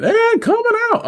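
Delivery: high, loud screamed quote; imitated screaming. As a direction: scream it out like her, high-pitched. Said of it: "She be in there screaming".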